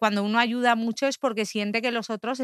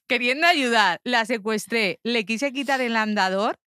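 usual speaking voice

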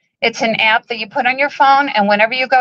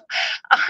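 choked tearful laugh; 5 dB quieter than usual. Real, not acted. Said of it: "I'm very emotional".

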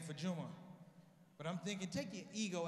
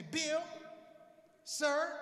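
higher, louder address; loud call-out; 8 semitones higher, 6 dB louder than usual. Pretend. Comic on Fox News, loud address to Bill. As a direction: call him out loud and sharp.

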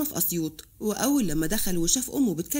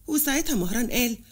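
ordinary delivery

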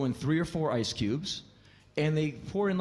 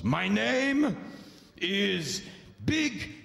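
much higher, slow, shouted boast; drunk cab driver; 6 semitones higher, much slower than usual. Retold or imitated. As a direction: announce yourself like a drunk big shot. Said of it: "introduce themselves".

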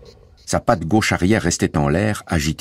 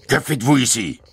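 sudden high-pitched holler; brutish yell; 7 semitones higher than usual. A narrator's reading. Guard confronts the family on the land, brutish yell. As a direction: holler it like a bullying brute. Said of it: "hurla soudain cette brute".

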